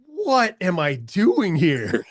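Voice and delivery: slow, laughing at his own doubt; laughing disbelief. Retold or imitated. Watching polished rivals audition, slow laughing disbelief. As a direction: laugh it off, drawn out.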